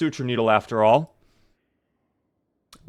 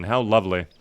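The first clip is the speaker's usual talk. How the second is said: ordinary talk, nothing marked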